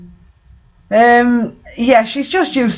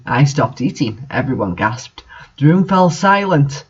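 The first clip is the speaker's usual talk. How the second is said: ordinary talk, nothing marked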